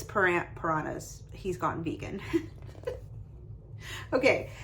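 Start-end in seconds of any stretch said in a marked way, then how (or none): none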